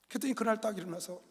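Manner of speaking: usual speaking voice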